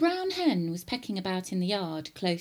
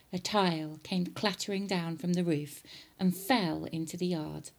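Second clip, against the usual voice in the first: plain reading voice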